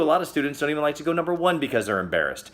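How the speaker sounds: ordinary talk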